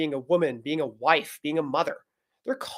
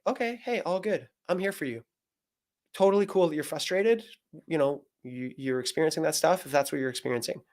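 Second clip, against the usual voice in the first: softer, easygoing reassurance; gentle reassurance; 4 dB quieter than usual. Acted. Husband accepting his wife's apology, softly reassuring. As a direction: soothe her without any blame. Models calm, supportive reply to wife.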